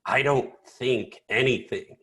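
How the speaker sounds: imitating a slightly gruff man, slow and deliberate; lightly voiced gruff quote, each word measured out; Ken French. Quoting a finance researcher to clients, lightly imitated gruffness. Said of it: "he's a little bit of a gruff man".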